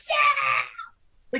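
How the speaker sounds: drawn-out crowd cheer; retold cheer. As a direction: whoop like a thrilled fan.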